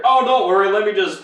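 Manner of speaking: fake-reassuring guy voice; hammed-up sly helper; mock smooth hero. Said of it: "It's like".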